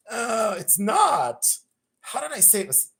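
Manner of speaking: real voice gone haywire after laughing; voice going crazy. Real, not acted. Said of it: "Why did my voice just go crazy".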